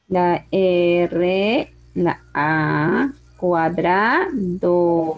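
low, slow, stretched syllables; spelling dictation. Shown as a demonstration. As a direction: dictate it letter by letter, lingering on each sound. Teacher spells and sounds out word.

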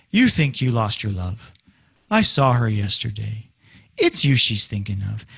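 low, level line that never climbs; wrong low melody. Shown as a demonstration. Teacher showing a melody that makes no sense, low and unrising. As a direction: keep every phrase low, no build in suspense. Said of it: "for the melody to go".

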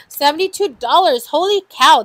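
gasp, then loud high exclamation; real astonishment. Real, not acted. Host sees the seventy-two dollar total, loud astonishment. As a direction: blurt it out fast and amazed.

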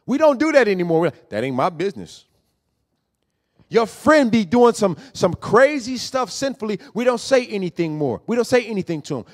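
loud, pushing sermon voice; preaching at full force. Acted. Preacher calls out the brothers and sisters, loud and pressing. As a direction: call them out from the pulpit.

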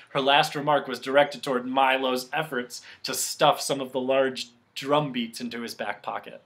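plain reading voice

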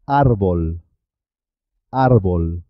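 árbol with a single tapped R; simple R. Shown as a demonstration. Teacher gives árbol as an example, R not rolled. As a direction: tap the R in árbol lightly. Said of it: "y suena simple".

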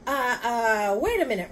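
loud, high, hesitant interruption; stammer, then cut in urgently; alarmed stalling. Acted. Father stopping the rhinoceros from drowning him, alarmed.

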